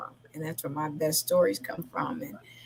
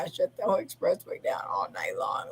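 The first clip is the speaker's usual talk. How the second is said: usual speaking voice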